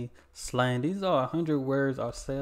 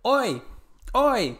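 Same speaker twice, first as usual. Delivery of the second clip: high, raised "Oi!" to hail someone; attention-getting call; 9 semitones higher, 4 dB louder than usual. Shown as a demonstration. Demonstrating Aussie slang to viewers, high attention-getting call. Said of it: "say to get someone's attention".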